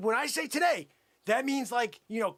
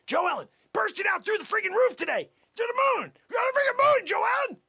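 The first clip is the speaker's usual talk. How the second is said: very high, loud sales frenzy; hyped-up shouting; 11 semitones higher, 5 dB louder than usual. Acted. Salesman promises Joellen the moon, hyped shout. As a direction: whip the buyer into a frenzy.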